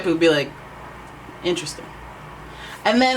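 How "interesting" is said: softer, understated show of interest; reserved deadpan. Acted. Imitating a reeled-in Aquarius reacting, mildly deadpan. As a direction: react with barely any emotion, acting it out. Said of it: "serious or brought, reeled in emotionally".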